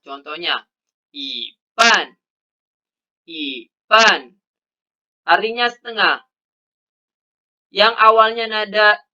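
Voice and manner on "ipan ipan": "ipan" with yi in a rising second tone; Mandarin tone change. Shown as a demonstration. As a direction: model "ipan" with a rising first syllable. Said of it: "bila bertemu nada empat berubah menjadi nada dua".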